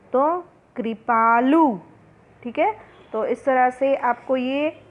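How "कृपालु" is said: कृपालु said slowly, कृ clear; slow teacher reading. Shown as a demonstration. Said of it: "री की मात्रा".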